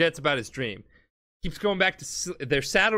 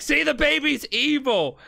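much higher mock-hysterical cry; frantic insistence; 10 semitones higher, 3 dB louder than usual. Kakuin accusing the baby before disbelieving friends, frantic insistence. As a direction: imitate someone desperate to be believed.

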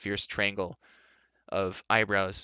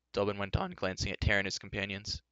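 usual speaking voice

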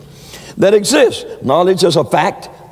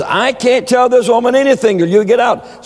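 mock screaming witch; 9 semitones higher than usual. Preacher acting the fortune teller ordering his mother out in a mock scream. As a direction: play the fortune teller shrieking her out.

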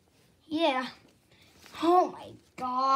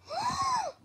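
sudden high gasp of realization; sharp gasp; 6 dB quieter than usual. Acted. Toy remembers the prank day, sharp gasp. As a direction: gasp as it dawns on you.

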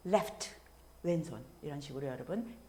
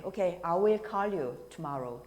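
slow clear "I will call you"; slow promise example; much slower than usual. Shown as a demonstration. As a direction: say it slowly for learners. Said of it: "이렇게 말씀하실 때".

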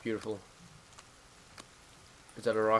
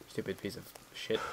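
muttered curse; 4 semitones lower, 10 dB quieter than usual. Real, not acted. Cursing unfit character.

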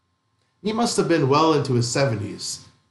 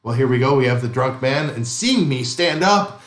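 ordinary delivery

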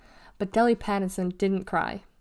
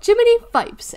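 very high, loud burst of cheer; loud, high-pitched gleeful exclamation; 10 dB louder than usual. A narrator's reading. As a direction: cheer it out on waking. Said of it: "she'd cheer".

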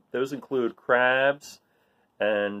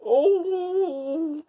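delighted laugh; 3 dB quieter than usual. Acted. Barnacle delighted by playmate.

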